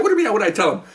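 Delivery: high-pitched puzzled comeback; retold incredulous reply; mock bafflement. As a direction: throw the question back, high. Retold astonished exchange with lieutenant.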